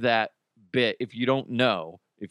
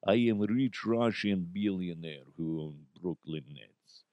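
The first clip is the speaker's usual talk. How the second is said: low, soft, deadpan Russian accent; subdued straight-faced Russian accent; real Russian accent; 7 semitones lower, 6 dB quieter than usual. Shown as a demonstration. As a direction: play a serious Russian businessman, low and understated. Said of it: "do a real Russian accent".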